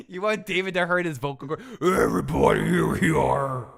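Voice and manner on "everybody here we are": monster truck ad. Pretend. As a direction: boom it like a monster truck rally. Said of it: "Do it on the monster truck".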